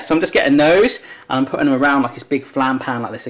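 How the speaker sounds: ordinary talk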